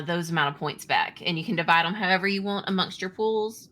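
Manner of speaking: ordinary talk, nothing marked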